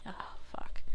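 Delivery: whispered to herself; barely voiced, under the breath; whispered aside. Real, not acted. Muttering to herself mid-game, whispered. Whispered curse, losing train.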